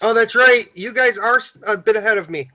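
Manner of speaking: ordinary talk, nothing marked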